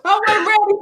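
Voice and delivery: high laughing voice, near tears; laughing through tears. Real, not acted. Tearful laugh, no tissue.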